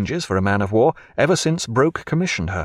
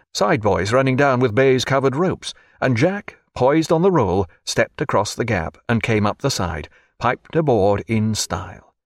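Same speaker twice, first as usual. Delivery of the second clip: plain reading voice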